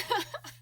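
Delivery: teary laugh through crying. Real, not acted. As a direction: laugh while still crying.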